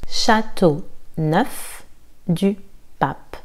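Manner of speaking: "Châteauneuf-du-Pape" stretched out slowly; very slow drill. Shown as a demonstration. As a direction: spell out each syllable of "Châteauneuf-du-Pape".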